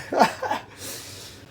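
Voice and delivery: short high, breathy laugh; quick voiceless giggle. Real, not acted. Laughs at the marshmallows.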